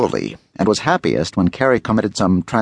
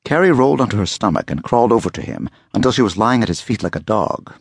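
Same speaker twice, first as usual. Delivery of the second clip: plain reading voice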